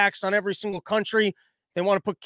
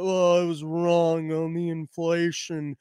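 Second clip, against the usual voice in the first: a slow, lower hint of the Yellen voice; mock Yellen; 3 semitones lower, slower than usual. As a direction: drag out the apology in her voice. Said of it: "where she goes".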